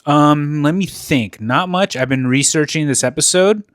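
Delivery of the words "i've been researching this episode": usual speaking voice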